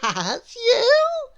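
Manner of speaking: very high, laughing reply. Acted. Miss Contrary laughs at Mister Small, very high. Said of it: "laughed little Miss Contrary".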